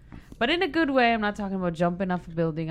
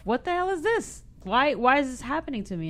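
higher, pleading disbelief; despairing outcry; 7 semitones higher than usual. Retold or imitated. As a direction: cry out in frustrated despair. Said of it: "so I was like".

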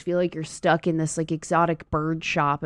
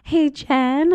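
put-on high little kid voice; a child calling out to her sitter; 10 semitones higher, 3 dB louder than usual. A girl calling her babysitter, in a high kid voice.

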